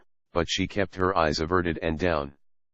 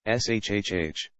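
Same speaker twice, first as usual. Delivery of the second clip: a long hushing shh, finger to her lips; shushing; 2 semitones higher than usual. A narrator's reading. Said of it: "laid his index finger over her mouth".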